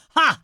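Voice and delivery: sharp high triumphant ha; cackling old man's ha; scoffing ha. Acted. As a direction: bark a shrill scornful laugh.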